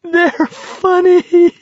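very high, drawn-out hysterical squeal; weeping with laughter. A narrator's reading. Garrity answers his worried friend, nearly weeping with laughter. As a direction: squeeze the words out through helpless laughter, slowly. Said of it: "nearly weeping with laughter".

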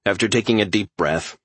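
ordinary delivery

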